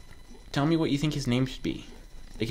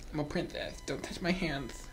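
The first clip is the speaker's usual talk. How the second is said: princess; 4 semitones higher, 9 dB quieter than usual. Pretend. Plays the character as a princess.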